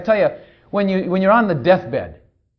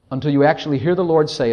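ordinary delivery

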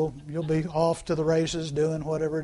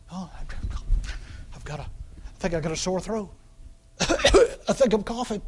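mock sick complainer. Preacher mocking a congregant who imagines being sick, whiny. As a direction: ham up a self-pitying sick person. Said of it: "when you feel like you're sick".

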